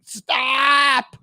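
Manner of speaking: high, drawn-out mock shout; exasperated cry. Dad begging daughter not to jinx team, exasperated cry. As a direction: wail it as a desperate plea.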